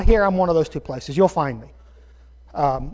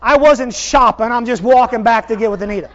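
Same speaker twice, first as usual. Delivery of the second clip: raised, emphatic self-defence; self-justifying; 4 semitones higher, 7 dB louder than usual. Retold or imitated. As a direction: argue with yourself, a bit too loud. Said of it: "I'm going".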